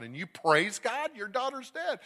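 high-pitched disbelieving challenge; incredulous. Retold or imitated. Nurse questioning a father who praises God, incredulous. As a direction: rise high in stunned disbelief.

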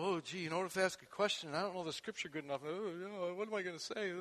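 soft, fast, fretting mumble; hand-wringing, lightly played; mock worried. A churchgoer fretting about scripture, mock anxious. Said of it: "It takes the pressure off".

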